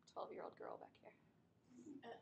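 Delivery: quick whispered aside; whispering. Real, not acted. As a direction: whisper it under your breath. Aside to someone at back.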